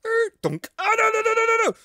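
high loud rapid noes; very high frantic no no no; 1 panicked cry. Real, not acted. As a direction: panic as it all goes wrong. Panic as he falls.

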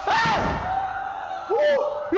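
high sudden yelp of fright; startled scream. Real, not acted. Streamer jumpscared in horror game, high startled yelp. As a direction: scream like something jumped out.

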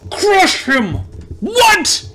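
high-pitched yelp of disbelief; shocked outburst. Real, not acted. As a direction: yell it high, startled by the shot. Game shot goes wrong.

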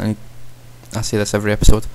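normal talking voice

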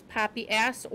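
plain reading voice

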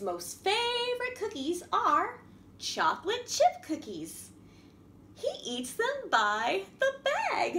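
put-on lively, sing-song storybook reading for little kids; reading to children. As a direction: read it like a picture book to a class. Reads a picture story to children.

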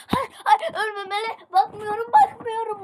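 high, loud, crying panic; panicked wail. Real, not acted. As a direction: wail in despair, can't watch. Panicking as character dies.

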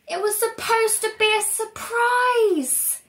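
high, loud, slow wail; upset wail. Acted. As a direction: wail over the spoiled surprise. Velda wailing over spoiled surprise.

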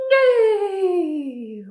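loud, high whinny sliding down; whinny like a horse; long imitated neigh. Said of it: "and it goes".

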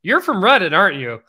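high, loud teasing accusation; mock suspicious. Meeting Reddit guests at the party, loud teasing jab. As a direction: call out a guest with an amused jab. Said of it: "where I'm like".

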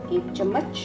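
ordinary talk, nothing marked

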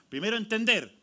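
ordinary talk

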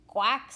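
short, loud imitated quack; duck quack. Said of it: "said the duck".

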